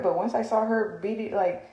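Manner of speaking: ordinary delivery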